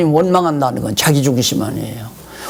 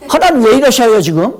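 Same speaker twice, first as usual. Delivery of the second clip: higher, rising indignant question; indignant protest; 7 semitones higher, 4 dB louder than usual. Retold or imitated. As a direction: protest to God, baffled. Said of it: "전폭 의지하는 마음이 있는 것이 아니라". (억울한 항의)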